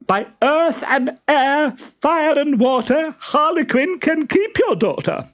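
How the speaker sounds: high shrill fleeing cry; shrieking. A narrator's reading. Stentorello running off home, shrieking. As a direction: shriek it as you run. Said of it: "shrieked Stentorello".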